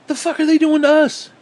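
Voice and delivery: high, loud shrieking outrage; mock outrage. Ranting at hawks, shrieks a mock-outraged high question. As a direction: screech it like a panicked hawk.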